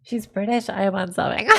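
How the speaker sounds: ordinary talk, nothing marked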